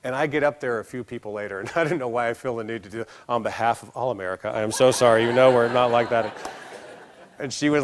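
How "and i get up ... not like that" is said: ordinary talk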